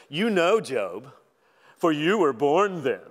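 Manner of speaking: preacher voicing God's sarcasm at Job; sarcastic God. Shown as a demonstration. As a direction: lay the sarcasm on thick, teasing Job. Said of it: "if you ever wondered if God was sarcastic".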